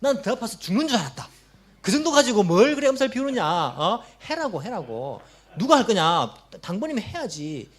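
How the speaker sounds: impatient, belittling nag; scornful scolding. Retold or imitated. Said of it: "이렇게 말하시는 분이 있습니다".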